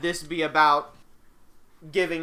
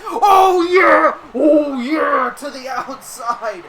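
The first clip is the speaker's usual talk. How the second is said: yelling with delight, much higher and louder; excited shout; 10 semitones higher, 10 dB louder, much slower than usual. Acted. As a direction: erupt at the big move. Big move, commentator erupts.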